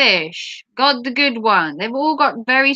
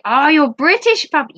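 ordinary delivery

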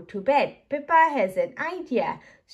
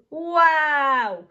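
loud, higher storybook wow; drawn-out acted cry of amazement; amazed shout; 5 semitones higher, 6 dB louder than usual. A narrator's reading. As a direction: shout it out in wonder. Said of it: "shouts Peppa".

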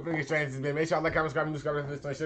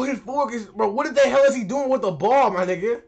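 ordinary talk, nothing marked

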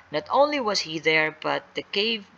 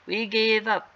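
higher-pitched searching call; 6 semitones higher than usual. Acted. Searching boys to missing Sally, calling out. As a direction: call to someone hidden, pitch raised. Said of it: "they called out".

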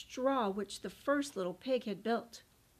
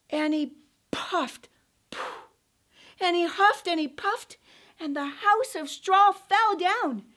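rising, louder telling; building excitement; 5 semitones higher, 7 dB louder than usual. A narrator's reading. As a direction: build to the crash. House collapses.

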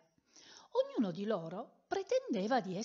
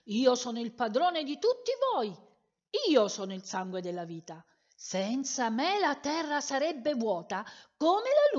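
louder, proud shout of the red colour; 4 semitones higher, 6 dB louder than usual. Acted. Storyteller voicing red shouting at the other colours. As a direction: bellow it out to silence the others. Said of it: "urlò".

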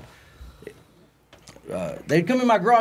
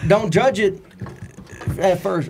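ordinary delivery

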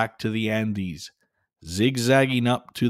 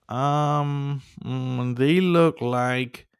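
hesitant Catface; 4 semitones higher, much slower than usual. Acted. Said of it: "added Catface Laguna".

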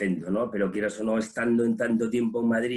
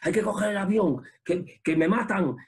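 retold panic; 6 semitones higher than usual. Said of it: "y yo".

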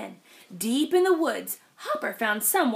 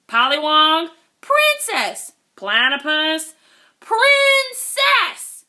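loud puzzled guesses; mishearing shout; 5 semitones higher, 9 dB louder, slower than usual. Acted.

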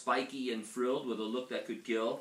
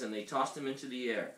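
ordinary delivery

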